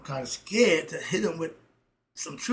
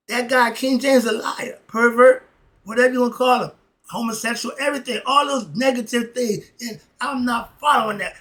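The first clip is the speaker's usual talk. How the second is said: high and loud, spitting out the insults; heated rant; 8 semitones higher, 11 dB louder than usual. Real, not acted. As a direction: blast him with passion. Heated rant against King James.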